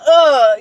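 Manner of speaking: sharp loud aggressive uh; mock fierce grunt; imitating his grunt. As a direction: grunt like a ninja. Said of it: "you know how he would say it".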